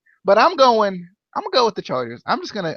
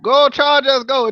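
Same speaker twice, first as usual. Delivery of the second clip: higher, louder chant; loud cheer; 6 semitones higher, 3 dB louder than usual. Pretend. Host cheering the Chargers loudly at his co-host. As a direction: cheer the team on. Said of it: "Did you hear him?".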